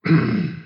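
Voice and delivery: low, short throat clear. A narrator's reading. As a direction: clear the throat before speaking. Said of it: "The Swami cleared his throat".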